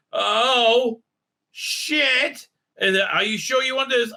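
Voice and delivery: high, startled gasp of a curse; mock shock. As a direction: play the sudden cold feet. Sees the dead predecessor.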